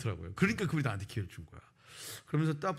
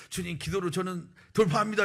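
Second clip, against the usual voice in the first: high, loud pledge of faith; zealous declaration; 6 semitones higher, 5 dB louder than usual. Retold or imitated. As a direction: imitate a fervent written declaration. Acting out his zealous application essay.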